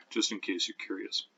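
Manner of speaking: ordinary delivery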